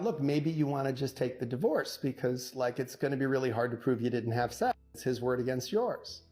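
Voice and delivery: normal talking voice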